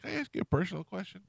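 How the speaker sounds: high, quick, sweetly polite question; mock-innocent high-pitched question; polite curious ask. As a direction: ask it brightly and fast, like a shy request. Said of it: "I go".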